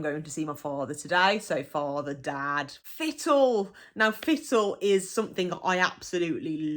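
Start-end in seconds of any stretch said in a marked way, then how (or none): none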